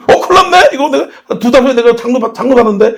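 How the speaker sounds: high-pitched comic fluster; mock alarm. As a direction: play up the panic for laughs. Acts out his alarmed reaction.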